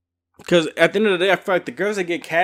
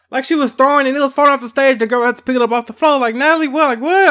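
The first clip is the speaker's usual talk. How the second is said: incredulous; 13 semitones higher, 6 dB louder, faster than usual. Real, not acted. Disbelief at tickets thrown offstage.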